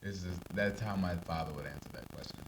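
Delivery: ordinary talk, nothing marked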